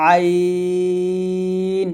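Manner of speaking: আইন drawn out long from the middle of the throat; আইন held long with throat pressure; Arabic letter demo. Shown as a demonstration. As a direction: press আইন from mid-throat, stretched slow. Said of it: "হলকের মাঝখান থেকে বলতে হবে চাপ দিয়ে".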